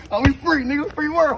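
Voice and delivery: high, loud celebration; jubilant. Pretend. Cellmate cheering that he is out of jail, jubilant.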